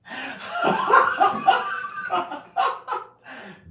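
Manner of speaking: a loud, high, breathy burst of laughter. Real, not acted. Laughing at own joke.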